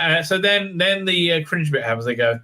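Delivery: ordinary delivery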